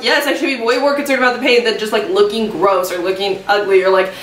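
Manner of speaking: ordinary talk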